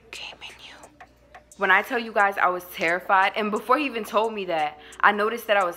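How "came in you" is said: hushed, breath-only retelling of a confession; whispered confession. Retold or imitated. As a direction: drop to a whisper. Retold confession, hushed for effect.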